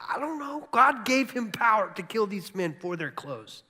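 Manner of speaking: high-pitched, loud bafflement; baffled protest. Real, not acted. Preacher to congregation on a hard passage, baffled and high. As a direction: throw up the question in disbelief.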